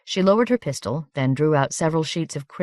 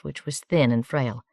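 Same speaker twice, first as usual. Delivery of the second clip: usual speaking voice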